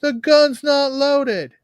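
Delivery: high, loud mock reassurance; Mazzilli. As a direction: call it out way up in pitch, drawing out the last line. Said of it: "even Mazzilli's like".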